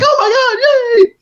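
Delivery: high, loud, laughing squeal of delight; excited squeal. Retold or imitated. Publisher's partner cheering a book deal, squealing with glee. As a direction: shriek with joy like the best news ever. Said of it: "he got all excited".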